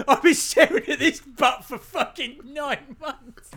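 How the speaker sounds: high, loud laughing protest; indignant and giggling; laughing outrage. Real, not acted.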